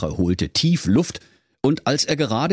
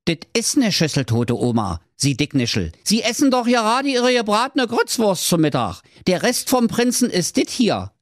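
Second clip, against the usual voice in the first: lightly voiced cheeky Berlin servant; contradicting servant; 11 semitones higher than usual. Servant correcting the king, cheeky and rising. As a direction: set the king right, rising to high. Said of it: "widersprach der Kammerdiener".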